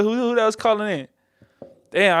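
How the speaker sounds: usual speaking voice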